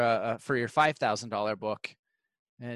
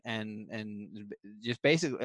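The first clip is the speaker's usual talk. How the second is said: normal talking voice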